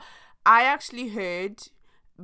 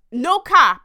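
emphatic; 9 semitones higher, 13 dB louder than usual. Real, not acted. Emphatic aside to viewers.

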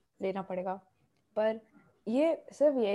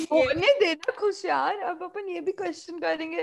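nosy neighbor aunty; 8 semitones higher, 5 dB louder than usual. Pretend. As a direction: play the nosy auntie next door. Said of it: "पड़ोस वाली aunty की voice में।".

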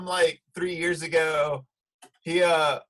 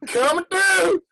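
high, loud Southern yell; hammed-up Southern surfer holler; hollered Southern warning; 10 semitones higher, 4 dB louder, slower than usual. Warning others while dropping in, Southern yell. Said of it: "I go".

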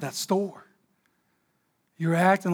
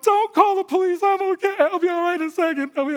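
tearful reassurance; 12 semitones higher, 3 dB louder than usual. Retold or imitated. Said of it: "people are looking at me like".

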